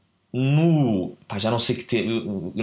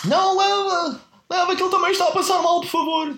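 mock pleading repeat. Aunt repeating her plea to God, high hammed-up insistence. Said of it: "e ela repete".

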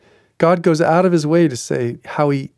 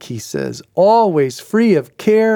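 ordinary talk, nothing marked

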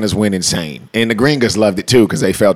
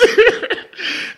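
high breathy laugh. Real, not acted. Laughs after the quote.

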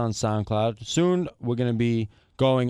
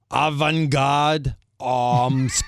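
very slow, unsure foreign name; halting try at a Russian name; slow sounding out; 2 semitones higher, much slower than usual. Real, not acted. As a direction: drag each syllable out carefully.

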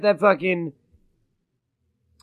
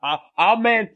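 higher mock moaning; Jesse; 6 semitones higher, much slower than usual. Podcaster replaying his fired friend's call, hammed-up fuming oohs. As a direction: sputter wordlessly like a fuming friend. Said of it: "you were like".

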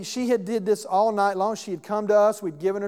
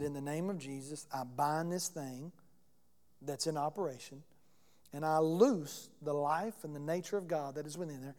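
hushed prayer; 5 semitones lower, 10 dB quieter than usual. Retold or imitated. Praying softly over sleeping child.

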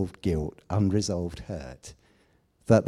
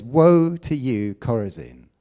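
Jesus pronouncing woe on the cities, at full preaching force; preached woe; 7 dB louder than usual. Acted. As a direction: call down woe like a prophet from the pulpit. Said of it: "He says".

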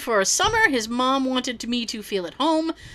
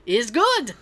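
bad Russian accent. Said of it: "I don't have a good Russian accent".

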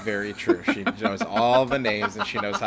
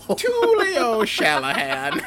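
slow, higher hammed-up Irish name; fake Irish accent; 14 semitones higher, 5 dB louder, much slower than usual. [name] showing off his mock Irish accent, hammed-up Irish.

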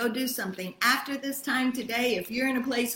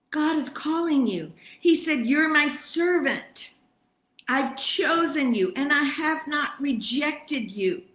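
fervent preaching; 5 semitones higher, 4 dB louder than usual. Acted. Preacher proclaiming God's call.

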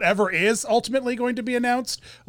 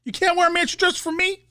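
squeaky mock scolding; pitched up, playful; high silly voice; 11 semitones higher than usual.

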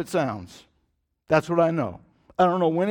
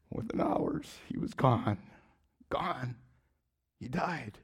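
softer, halting words about his father's death; hushed grief; 9 dB quieter, slower than usual. Real, not acted. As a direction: let the loss quiet the voice.

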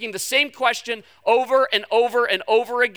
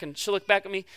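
usual speaking voice

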